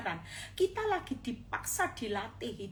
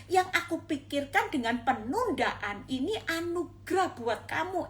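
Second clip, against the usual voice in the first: usual speaking voice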